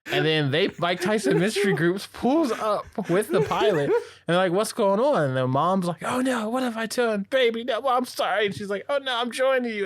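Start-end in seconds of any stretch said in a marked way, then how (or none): 4.5-5.2 high-pitched mock alarm; imitating a startled arrival; alarmed retold question. Retold arrival at shooting scene. 6.0-8.5 high, hammed-up panicked apology; playful retold wail of regret; mock horrified mother. Retold mother realizes she shot daughter. 8.9-9.8 high, hammed-up despair; playful retold desperation; mock despairing mother. Retold mother before killing herself.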